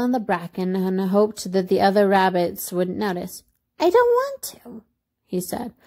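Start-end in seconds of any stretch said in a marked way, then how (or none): 3.8-4.8 very high toy-rabbit voice; high-pitched storybook refusal; reluctant little rabbit. A narrator's reading.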